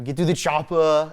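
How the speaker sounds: loud, slow Arnold Schwarzenegger bark; Arnold impression. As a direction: shout it like the action hero in Predator. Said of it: "I'll give you the Arnold".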